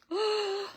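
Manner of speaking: sharp delighted high gasp; a quick gasp of delight at the paper. Real, not acted. Crafter gasping at the pretty paper. Unboxing a lovely new stamp set.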